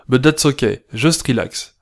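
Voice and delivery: usual speaking voice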